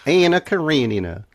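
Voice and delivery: high, drawn-out mock Georgia accent; terrible Southern accent. Riffing on Anna Karenina set in Georgia, terrible Southern accent. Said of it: "my accent is terrible".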